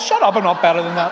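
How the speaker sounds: loud retort; mock snap at wife. Pastor snapping at his wife, loud. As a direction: snap back at her. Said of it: "And I went".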